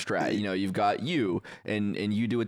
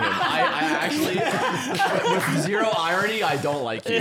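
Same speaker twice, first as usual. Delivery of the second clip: laughing so hard the pitch jumps; loud giggling protest; 6 semitones higher, 4 dB louder than usual. Real, not acted. Laughing through mock complaint.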